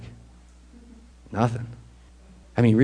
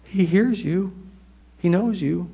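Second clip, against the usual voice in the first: gossiping voice; 8 semitones higher, slower than usual. Retold or imitated. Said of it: "the people talking in the laundry room".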